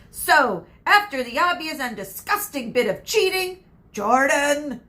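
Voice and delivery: growled warning. Acted. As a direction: scold him hard and loud. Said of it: "growled Professor McGonagall".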